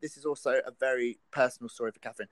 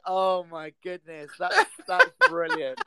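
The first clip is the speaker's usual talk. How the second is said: laughing through words; 2 semitones higher, 5 dB louder than usual. Real, not acted. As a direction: say it while laughing hard. Laughing at story.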